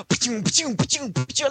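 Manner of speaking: high, popping camera noises; imitated flashbulbs. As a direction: mimic a volley of flashbulbs. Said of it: "flash bulbs and scri".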